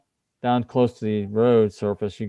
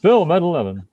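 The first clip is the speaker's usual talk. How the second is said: booming newscaster, higher and louder; news announcer voice; 7 semitones higher, 5 dB louder than usual. Pretend. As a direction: echo it like a TV anchor. Echoes announcer line.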